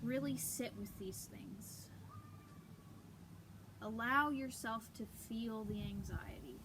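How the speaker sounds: calm, unhurried meditation voice with long pauses; guided meditation. Acted. Leading viewers through breathing and prayer, guided meditation. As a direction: softly guide them into stillness and prayer.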